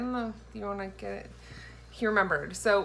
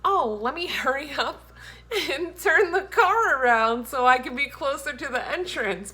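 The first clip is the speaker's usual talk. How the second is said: mock thought; 6 semitones higher, 6 dB louder than usual. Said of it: "I think".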